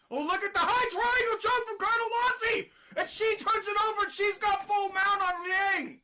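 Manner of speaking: erupting as the triangle choke lands; excited call. Real, not acted. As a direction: lift the crowd with the reversal. Big reversal in live fight.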